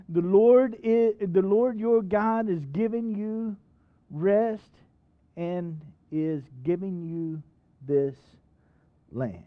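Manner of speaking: drawn-out words, each one weighted; slow emphatic reading. Acted. Preacher reading scripture to church, slow and weighty. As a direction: lean on every word of the promise.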